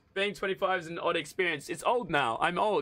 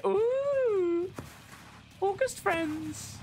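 much higher, slow cooing voice; high sing-song coo; 13 semitones higher, much slower than usual. Real, not acted. Streamer cooing at chat about August birthdays, high and slow. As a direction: coo it out like a silly little song.

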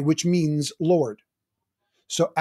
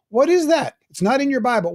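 high, louder mock bewilderment; puzzled objection; 8 semitones higher, 4 dB louder than usual. Teacher voicing a churchgoer puzzled by words in songs, mock bewildered. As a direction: voice a baffled listener, pitched well up.

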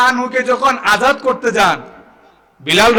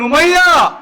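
calling out a name; 7 semitones higher than usual. Retold or imitated. Retold challenge to slave owner.

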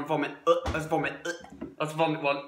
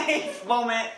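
laughing; 6 semitones higher than usual. Real, not acted. Laughing at the taste.

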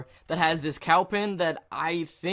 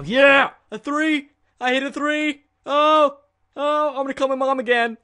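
high, loud triumphant shouting; ecstatic celebration; 10 semitones higher, 8 dB louder than usual. Real, not acted. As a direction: yell it out, overjoyed. Celebrates hitting a three.

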